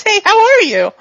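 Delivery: high, loud, bouncy hello; cheery reset greeting. Acted. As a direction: snap back into an oblivious, friendly greeting. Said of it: "he blurs for a second".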